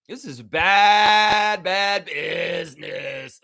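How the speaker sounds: bad stretched slow and hard; slow pounding bad business; slow emphasis. Real, not acted. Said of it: "repeated slowly".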